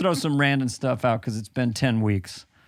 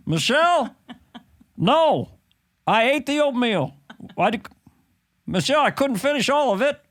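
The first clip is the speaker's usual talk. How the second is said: higher-pitched henpecked president; Barack Obama; 8 semitones higher than usual. Acted. President defending uneaten oatmeal to his wife, impersonated. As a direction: plead your case to your wife, in presidential voice. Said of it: "this is Barack Obama".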